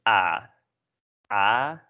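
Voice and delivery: long open "ah" for Dutch A. Shown as a demonstration. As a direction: say the A as a drawn-out Dutch "ah". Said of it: "In Dutch, it is pronounced as".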